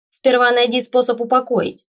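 high, loud teasing comeback; cheeky taunt. A narrator's reading. Undead assistant defying the boss, loud cheeky taunt. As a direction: throw it back tauntingly. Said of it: "показал тот язык".

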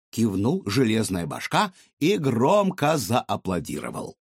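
ordinary talk, nothing marked

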